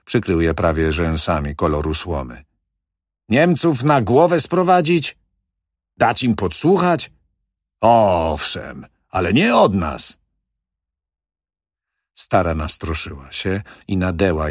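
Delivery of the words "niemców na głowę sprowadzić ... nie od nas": loud, high scolding questions; angry manager. Acted. Manager rebuking the old widow's idea, loud and angry. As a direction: rebuke her sharply.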